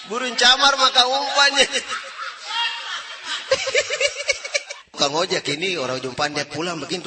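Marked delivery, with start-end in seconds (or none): 1.8-4.7 very high, long laugh; high laughter. Real, not acted. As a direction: laugh helplessly at the punchline. Laughing after punchline.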